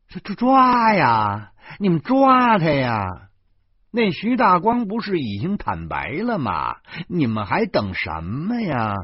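high, loud, slow mocking demand; sarcastic outburst. A narrator's reading. Narrator loudly mocks the police for not arresting him. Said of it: "我的口气里头全都是挖苦".

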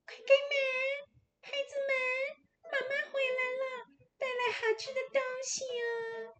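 put-on a wolf squeezing his voice thin to fool the kids. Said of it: "他的声音是变细了".

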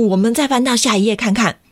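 usual speaking voice

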